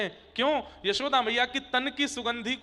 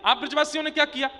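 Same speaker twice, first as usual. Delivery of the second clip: louder and higher, fast indignant challenge; indignant accusation; 5 semitones higher, 5 dB louder, faster than usual. Acted. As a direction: fire the question back at them quickly. Thakur ji accuses the villagers.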